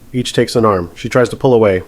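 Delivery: plain reading voice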